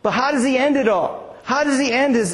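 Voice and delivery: ordinary talk, nothing marked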